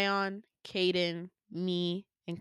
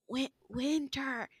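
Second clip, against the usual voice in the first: slow, halting, higher broken word; struggling speech; 5 semitones higher, 5 dB quieter, slower than usual. Retold or imitated. Dying clone trying to say her name, struggling. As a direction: strain to get the name out. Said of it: "like, barely communicate".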